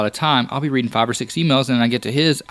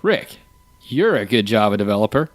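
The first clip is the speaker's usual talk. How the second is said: doubtful "you're a good Java developer?", low with a final rise; questioning tune; 3 semitones lower than usual. Shown as a demonstration. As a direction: lean on "you're", then lift "developer" into a question. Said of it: "What if I say".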